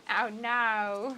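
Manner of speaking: exaggerated Australian "oh no"; Aussie "oh no". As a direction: stretch out an Aussie "no". Mimicking the drawn-out Aussie no.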